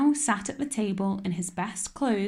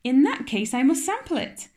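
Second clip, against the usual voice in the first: laughing; 5 semitones higher, 3 dB louder than usual. A narrator's reading. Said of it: "laughed Missus Allen".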